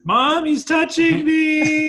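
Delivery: put-on high, slow whining child; whiny kid. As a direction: whine like a little brother tattling. Brothers bickering, whining to mom.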